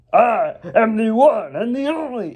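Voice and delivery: high, showy villain boast; hammed-up high proclamation; Garmadon. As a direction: proclaim it like a self-important villain. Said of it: "he's like, um".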